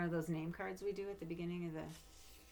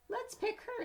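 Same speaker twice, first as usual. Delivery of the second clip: very high, sing-song taunt; mock her like a flower; bratty tease; 3 dB louder, slower than usual. Classmate teasing.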